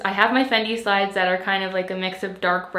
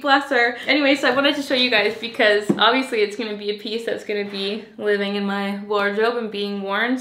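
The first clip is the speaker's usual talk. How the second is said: ordinary talk, nothing marked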